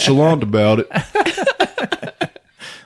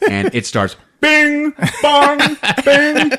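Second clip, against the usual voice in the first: hammer clangs; slower than usual. Retold or imitated. Host imitating the snake's hammer striking nails. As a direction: ring out the hammer blows in a high voice. Mimicking the hot hammer blows.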